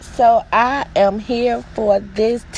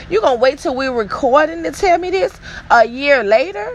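usual speaking voice

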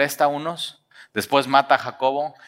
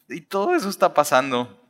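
usual speaking voice

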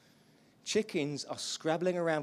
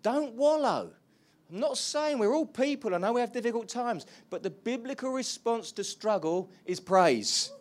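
loud, high, fervent preaching; preaching at full force; 8 semitones higher, 8 dB louder than usual. Acted. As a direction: lift the room with passionate praise. Preaching the praise response.

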